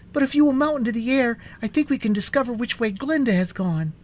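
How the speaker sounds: high, hurried, nervous suggestion; nervous urgency. A narrator's reading. Boy urging the Gump into the air, nervous and hurried. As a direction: rush it out in a high, anxious voice. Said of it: "who was very nervous at the delay".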